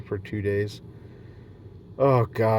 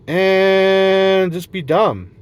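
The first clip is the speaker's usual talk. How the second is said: higher and louder, stretched very slowly; dragged-out carefree cry; 7 semitones higher, 7 dB louder, much slower than usual. Pretend. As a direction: let it out like a happy prayer to nobody. Praying to be free and silly.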